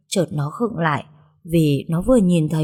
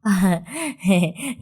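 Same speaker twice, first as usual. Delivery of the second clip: sheepish chuckle. A narrator's reading. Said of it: "cười hề hề".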